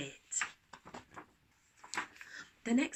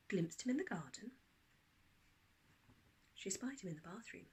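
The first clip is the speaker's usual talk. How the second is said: ordinary delivery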